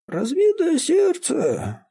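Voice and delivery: put-on very high, slow old woman's wail; old woman echoing. A narrator's reading. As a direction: echo the words in a drawn-out high old voice. Old woman repeats what she overheard.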